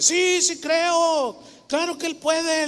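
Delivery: slow, high, ringing yes of belief; fervent shout of faith. Retold or imitated. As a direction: cry out your faith with everything. Grandfather declares faith in meeting.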